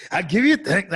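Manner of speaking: put-on loud, high, botched Scottish accent; attempted Braveheart accent. Failing at the co-host's Braveheart line, mock Scottish accent. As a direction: push out a clumsy Scottish accent. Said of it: "I can't fucking do it".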